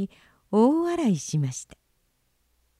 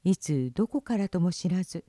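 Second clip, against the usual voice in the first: usual speaking voice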